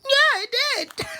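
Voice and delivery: very high, squeaky mock answer; imitating Steven. Mocking Steven's answer to Nick, squeaky high voice. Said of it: "He said".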